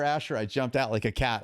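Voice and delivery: ordinary delivery, nothing marked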